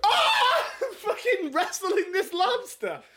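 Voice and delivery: high-pitched startled scream, laughing; panicked shriek. Pretend. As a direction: shriek as if grabbed by a lobster. Acting out finding lobster in bag.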